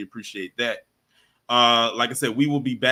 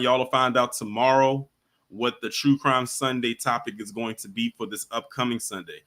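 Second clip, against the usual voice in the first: ordinary talk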